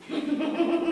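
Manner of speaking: a short mocking laugh; a brief mocking chuckle. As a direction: laugh at him. Laughs at the defendant.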